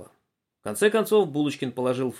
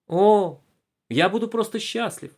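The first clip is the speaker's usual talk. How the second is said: вежливый ребёнок; 8 semitones higher, 4 dB louder, slower than usual. A narrator's reading. Ребёнок отвечает.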